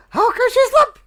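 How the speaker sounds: mock outraged squeal of "How can she slap?"; squealed meme quote. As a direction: shriek the meme line up high, "slap" rising. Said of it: "I love the Indian memes".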